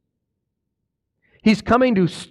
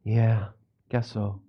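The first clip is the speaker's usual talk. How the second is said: mock bored mumble, lower and much softer; a deflated, half-hearted yes; unenthusiastic reply; 12 semitones lower, 9 dB quieter, slower than usual. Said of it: "are Christians excited about the millennial kingdom, yes or no?".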